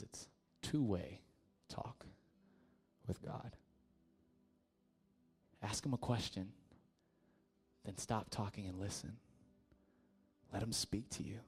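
very soft, near-whispered, with long pauses; hushed explanation. Acted. Friend gently explaining what prayer is.

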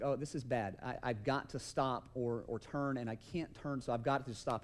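low, soft retold inner panic; understated dawning alarm, under the breath; mock-serious. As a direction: think it aloud, quietly and gravely. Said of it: "it's serious".

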